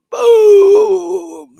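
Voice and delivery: huge drawn-out high BOOM; explosive mimicked thunder knock; imitated huge knock. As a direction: bellow a long, booming impact. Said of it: "it's not like a little knock".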